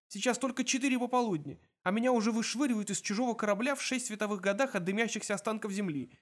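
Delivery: high-pitched running wail of grievance; lamenting. A narrator's reading. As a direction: pour out your woes, voice held high. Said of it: "запричитал Артур".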